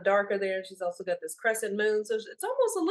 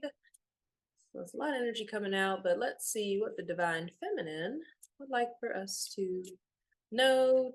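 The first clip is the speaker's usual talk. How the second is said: normal talking voice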